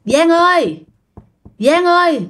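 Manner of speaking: high, drawn call through a door. Retold or imitated. Ghost at the door calling the young aunt, high acted call. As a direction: call a name from outside, pitched well up.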